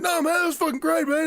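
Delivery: very high, insistent mock-drunk praise; drunk enthusiast. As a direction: imitate a drunk guy gushing. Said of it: "guarantee Grado was probably drunk".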